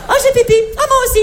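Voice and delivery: squeaky imitation of chatty girls; high girly voice. Comedian mimics girls heading to the toilet together, high and squeaky.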